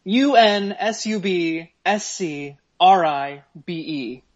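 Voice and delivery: each letter spelled slowly and firmly. Retold or imitated. Spelling the unsubscribe demand to the sender, slow and firm. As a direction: hammer every letter of U N S U B S C R I B E. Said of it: "all capital letters".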